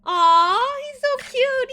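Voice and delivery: loud, high gushing aw; high-pitched delighted cooing; adoring squeal. Real, not acted. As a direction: melt over a cute dog. Gushing over the cute werewolf.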